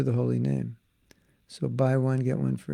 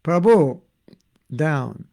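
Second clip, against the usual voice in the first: put-on Bengali accent; 2 semitones higher, 9 dB louder, much slower than usual. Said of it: "imagine how they say it".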